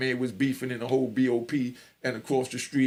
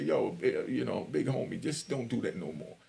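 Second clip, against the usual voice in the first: quiet stern warning; 4 semitones lower, 6 dB quieter than usual. Retold or imitated. Big friend's stern warning.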